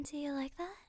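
ordinary talk

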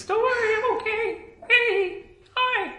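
very high, soft creature voice; tiny voice offering reassurance; mysterious little voice. Acted. Unseen creature reassuring the party, high little voice. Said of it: "you definitely hear a voice".

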